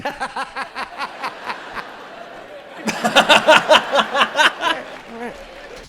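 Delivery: loud rolling burst of laughter; long loud laugh. Real, not acted.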